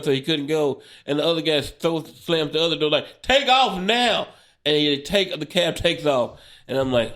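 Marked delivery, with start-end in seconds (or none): 3.2-4.3 shouted command. Retold or imitated. Security guard slams cab door.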